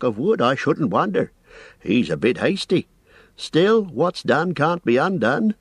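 a busy, apologetic innkeeper rambling on; fussy landlord. A narrator's reading. Landlord Butterbur voiced.

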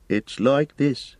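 hesitant, troubled. A narrator's reading. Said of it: "hesitating and looking troubled".

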